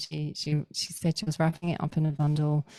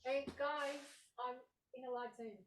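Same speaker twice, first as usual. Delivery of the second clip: high, very soft call away from the mic; hushed aside; 8 semitones higher, 15 dB quieter than usual. Real, not acted. Speaker to family during a live Zoom, real hushed aside. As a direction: quietly shush the household, pitched up.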